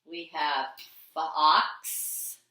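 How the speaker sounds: "fox" drawn out very slowly, rising; slow word review. Shown as a demonstration. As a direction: stretch "fox" for the class. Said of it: "go over them one more time".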